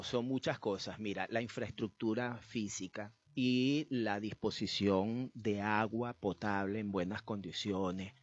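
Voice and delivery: ordinary talk, nothing marked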